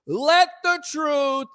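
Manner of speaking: slow preaching with drawn-out words. Real, not acted. Host preaching to fans, slow and drawn out. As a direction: preach it slowly to the choir. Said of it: "I feel like I'm preaching".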